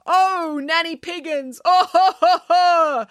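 high theatrical boo-hooing; hammed-up sobbing. A narrator's reading. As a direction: sob loudly like a bad actor. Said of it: "sobbed Missus Heseltine".

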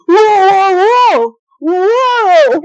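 loud, high imitation of a shouting dad; shouted alarm. Dad trying to stop fighting sisters, shouting.

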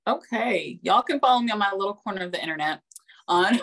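ordinary talk

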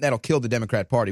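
ordinary talk

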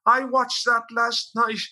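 put-on high, slow old lady voice; old woman complaining on the radio, high and slow; old woman's voice. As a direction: quaver it out like an old woman in her seventies. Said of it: "this old woman, I think in her seventies".

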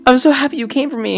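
high, relieved wife's voice; a rescued wife thanking her husband; grateful wife. Acted. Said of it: "She goes".

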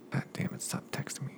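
hissed aside at the buzzing phone; annoyed whisper. Real, not acted. Speaker to the phone interrupting the story, annoyed whisper. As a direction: whisper it under your breath, irritated.

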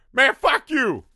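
high, loud brash put-down; a blunt mechanic lady telling the ranger off, loud and high; tough mechanic lady. Retold or imitated. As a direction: bark it at him like a fed-up local. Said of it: "the mechanic goes".

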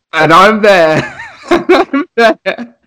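laughing helplessly through the words; can barely get it out for laughing. Real, not acted. Recalling absurd answer, laughing.

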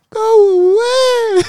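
put-on very high, loud crying toddler "Go away"; whiny toddler go away. Uncle imitating his niece crying at the grandmas. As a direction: wail it like a fed-up toddler. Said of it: "she was like".

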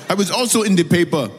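hammed-up Zimbabwean accent impression; mock Zimbabwean accent. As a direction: mimic her bragging in a Zimbabwean accent. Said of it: "I do that accent really well".

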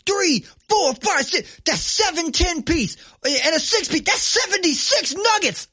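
high, loud put-on voice counting slowly; tally it up like a stunned cashier; counting coworker. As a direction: worker counting nuggets in a high mock voice. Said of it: "the person looks over and goes".

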